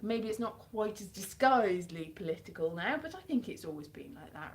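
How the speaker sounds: usual speaking voice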